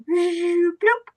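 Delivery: high cartoon pop, rising then plopping; playful bloop. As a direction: imitate a baby popping into existence. Imitates baby popping onto the planet.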